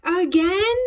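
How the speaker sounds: loud, high, disbelieving question; exasperated teacher. A narrator's reading. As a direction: ask it loudly, at the end of your patience. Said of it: "David's teacher always said".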